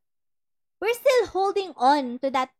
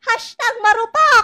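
laughing; 8 semitones higher, 9 dB louder than usual. Real, not acted. Laughing at the follower's question.

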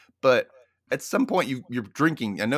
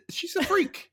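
excited shriek; slower than usual. Real, not acted. Cohost exclaims about character.

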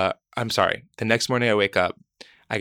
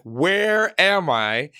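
drawn-out, high and louder in wonder; stunned "where am I", stretched out; awed disbelief; 8 semitones higher, 4 dB louder, much slower than usual. Retold or imitated. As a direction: marvel at where you've landed, slowly. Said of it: "And I'm like".

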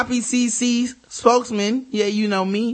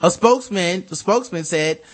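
ordinary talk, nothing marked

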